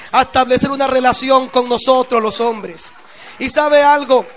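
loud, soaring sermon voice; fiery preaching. Acted. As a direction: preach it out to the whole church. Preacher at full force.

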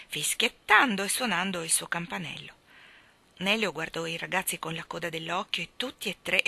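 usual speaking voice

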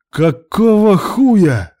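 very loud, high, drawn-out yell; outraged yell. A narrator's reading. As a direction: bellow it slowly in outrage. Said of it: "заорал Илья".

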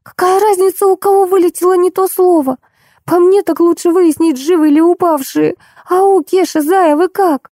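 very high squeaking voice throughout; squeaky. A narrator's reading. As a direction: squeak it out from under someone. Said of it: "пропищало существо, лежащее подо мной". (писклявым, очень высоким голосом)

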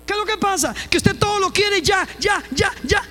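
high, loud preaching; fiery preacher scolding impatience; preaching shout. Real, not acted. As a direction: hammer the repeated ya.

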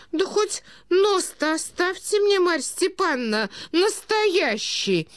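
put-on high-pitched wail of the old prince; stunned outcry. A narrator's reading. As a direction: beg in dismay, stretching the last word. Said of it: "вскричал князь, ошеломлённый такими внезапными откровенностями". (ошеломлённый князь, высокий жалобный вскрик)

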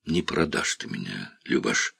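low, quiet, sure of her; quietly certain she won't betray him; a low murmur of confidence; low confident. A narrator's reading.

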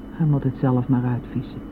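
usual speaking voice

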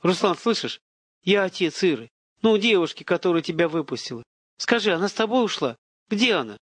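fervent, high pleading questions; urgent, heated. A narrator's reading. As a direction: press him urgently for news. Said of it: "горячо заговорил, понижая голос".

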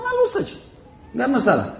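high-pitched, offhand mock of a hypocrite; breezy dismissal. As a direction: wave it off in a squeaky-high voice. Hypocrite dismisses threat of being reported.

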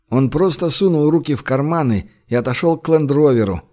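usual speaking voice

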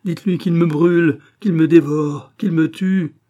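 high, loud, anguished plea; desperate cry. A narrator's reading. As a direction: cry out, begging for an end. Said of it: "s'écria André".

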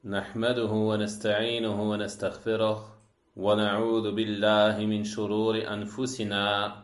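brisk, level-pitched liturgical recitation; Arabic sermon opening. Real, not acted. Lecturer opens a religious lesson for listeners, formal Arabic recitation. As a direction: intone the opening praise on a steady note.

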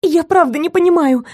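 high frightened cry. Acted. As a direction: cry it out in panic. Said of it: "крикнула я".

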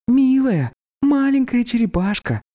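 put-on high, sugary baby-talk coo. A narrator's reading. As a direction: coo at the little turtle. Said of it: "просюсюкала Птрасси".

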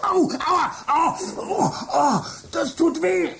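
high, yelping cries of pain. Acted. Michael shocked by his computer, high yelps of pain. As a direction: yelp as the current hits you.

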